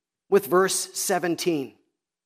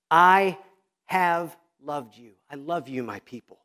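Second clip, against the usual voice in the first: tender, fading; 4 semitones lower than usual. Acted. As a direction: say it like a whispered promise to your people. God's love voiced tenderly.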